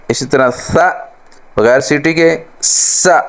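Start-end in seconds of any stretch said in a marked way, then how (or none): 2.6-3.2 سا with a sharp hissing whistle; whistled سا. Shown as a demonstration. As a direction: let سا whistle through the teeth. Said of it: "یہ سیٹی کے ساتھ". (سیٹی کے ساتھ سا)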